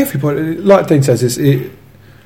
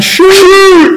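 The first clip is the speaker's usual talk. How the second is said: high, loud terrace yell; imitated crowd shout; 6 dB louder than usual. Fan retelling what everybody on the terrace yelled, high shout. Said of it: "Everybody started shouting".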